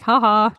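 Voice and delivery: loud, brief mock ha-ha; short, punchy put-on laugh; laughing off troubles. Shown as a demonstration. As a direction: toss out a fake laugh at trouble. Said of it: "practice laughing at life's troubles".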